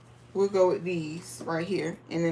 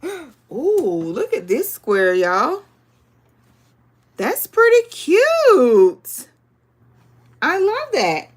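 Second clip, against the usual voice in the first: high, loud delighted squeal over fabric; excited gush; 5 semitones higher, 6 dB louder, slower than usual. Real, not acted. Quilter gushing to viewers over a cute square, squealing.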